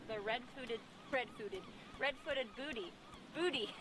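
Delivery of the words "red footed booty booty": ordinary delivery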